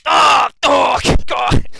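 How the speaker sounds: loud high yelp of pain; pained shout. Acted. Archer yells in pain as the halfling's oar hits him. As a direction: cry out as the oar hits your skull.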